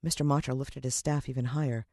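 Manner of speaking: usual speaking voice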